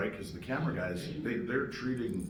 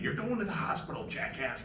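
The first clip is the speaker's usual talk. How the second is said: higher-pitched imitation of scolding, running into nonsense; mock yelling; 7 semitones higher than usual. Work wife ordering him to the hospital, mock yelling. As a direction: mimic a telling-off that dissolves into rah-rah noise. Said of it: "Cindy's yelling at me".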